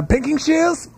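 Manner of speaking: very high-pitched mock British accent. Taking a turn at the challenge, high British accent. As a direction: squeak it as a question in put-on British. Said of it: "said in your best British accent".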